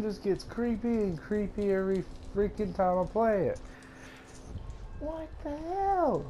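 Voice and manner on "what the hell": high, drawn-out what the hell; shocked. Real, not acted. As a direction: stretch it out in high disbelief. Startled again.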